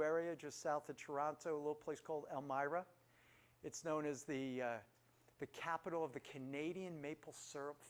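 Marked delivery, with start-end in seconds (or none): none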